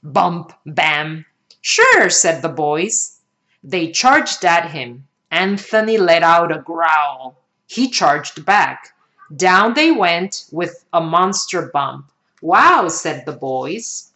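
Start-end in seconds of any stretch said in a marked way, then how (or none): 1.6-2.1 very high, loud eager yes; eager boys. Acted. Boys agree to play. 12.4-12.9 higher, loud amazed wow; be wowed by the crash; impressed wow. Acted. Boys impressed after crash.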